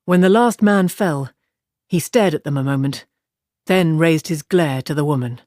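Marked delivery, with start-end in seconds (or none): none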